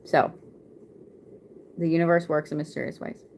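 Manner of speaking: usual speaking voice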